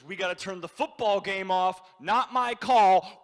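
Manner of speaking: loud, exasperated announcement that builds; upset bartender. Retold or imitated. Bartender turning off the football game, loudly upset. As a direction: imitate a bartender losing patience, drawing out the end. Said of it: "bartender who could not be more upset".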